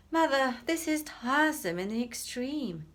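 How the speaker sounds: put-on a spoiled boy sighing at the tedium; lightly voiced haughty complaint; bored complaint. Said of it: "complained Ernest".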